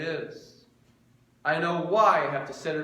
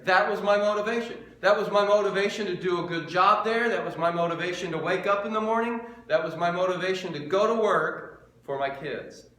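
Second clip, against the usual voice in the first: louder and higher, hammering each repeat; full-force preaching; 4 semitones higher, 6 dB louder than usual. Acted. Pastor telling the church what kept him going, preaching hard. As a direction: drive the point home line after line.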